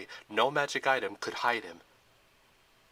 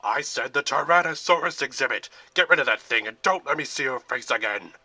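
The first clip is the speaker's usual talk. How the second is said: furious yelling; 9 dB louder than usual. A narrator's reading. General to the guard, loud furious yelling. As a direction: keep bellowing at him.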